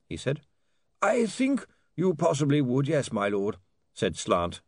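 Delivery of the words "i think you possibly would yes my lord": high character voice sinking into gloom; reluctant advice, falling away gloomily; gloomy lawyer. A narrator's reading. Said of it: "sinking further in gloom".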